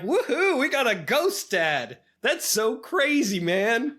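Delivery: high, slow, gleeful cheering; excited. Retold or imitated. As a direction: cheer like a carefree kid. Kids cheerfully accepting ghost dad.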